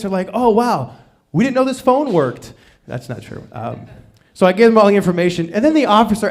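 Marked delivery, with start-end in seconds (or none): 0.3-2.5 higher-pitched, mildly amazed police voice; mock amazement. Police answering a rare theft report, mock amazed. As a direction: act lightly astonished that anyone called.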